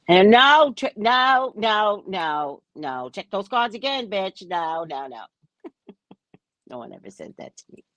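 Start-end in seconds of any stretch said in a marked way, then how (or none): 0.1-5.3 mocking a stubborn client who insists she's wrong; mock shouting denial.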